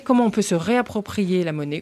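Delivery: ordinary talk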